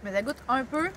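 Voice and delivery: speaking around a bite; chewing while talking. Real, not acted. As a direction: mouth partly full of food. Seen 2: Bites bar at -3, then visibly chews with puffed cheeks while talking.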